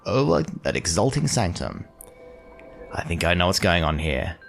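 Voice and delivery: ordinary talk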